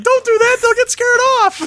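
high squeaky Minnie Mouse nurse. Retold or imitated. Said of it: "the nurse was Minnie Mouse".

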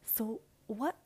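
ordinary talk, nothing marked

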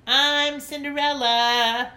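slow, high, very loud proud shout. Acted. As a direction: claim the role loudly before anyone else. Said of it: "she shouted".

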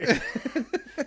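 high giggle at the joke; high burst of laughter. Real, not acted.

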